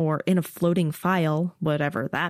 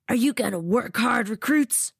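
higher, pushy drill instructor; drill instructor taunt; 6 semitones higher than usual. A narrator's reading. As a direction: goad the recruits. Laban taunts recruits.

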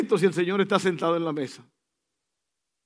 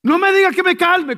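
much higher, louder outburst; mock indignation; 11 semitones higher, 9 dB louder, slower than usual. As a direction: bristle at being told. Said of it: "cuando dice". (la gente reacciona irritada, más alta y fuerte)

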